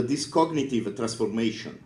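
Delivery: ordinary delivery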